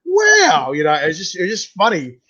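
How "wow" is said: high, loud amazed wow. Retold or imitated. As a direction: gasp it out in awe. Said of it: "I was like".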